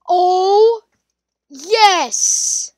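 high gasp then slow thrilled reveal; excited gasp. Real, not acted. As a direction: gasp and savour the discovery. Discovers sword shrine.

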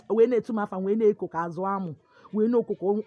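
normal talking voice

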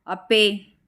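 short, restrained call of the name; a touch of sharp reproach; mild narrated shout. A narrator's reading. Arjun calls out to Appe, mildly shouting. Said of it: "पण अर्जुन ओरडतो".

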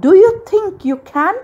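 high, quick rising question; loud, fast, high-pitched request; hopeful plea. A narrator's reading. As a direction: ask eagerly for a favour. Cindy asks fairy for a man.

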